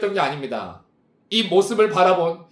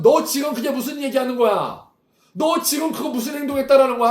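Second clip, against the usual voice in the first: a hint of an angry father, higher; demanding what he means, pitched up; father pointing and scolding, mildly raised voice; 5 semitones higher than usual. Father furious at son's confession.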